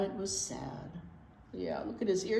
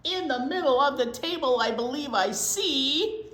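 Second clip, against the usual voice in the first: slow, louder, drawn-out build; building suspense; 5 semitones higher, 9 dB louder than usual. A narrator's reading. Reader slows toward the surprise party, louder. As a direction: stretch it to the big reveal.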